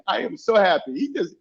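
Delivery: usual speaking voice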